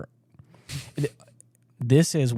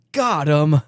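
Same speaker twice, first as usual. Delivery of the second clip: mock wooden soldier line, pitched up a little; stilted dubbed line; 4 semitones higher, 3 dB louder than usual. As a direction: read it stiff and unnatural, like the dubbed captain. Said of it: "he looks away and goes".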